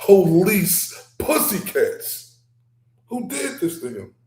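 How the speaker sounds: outraged rant. Real, not acted. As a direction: rail loudly at the police, drawing out the insults. Outraged rant about police.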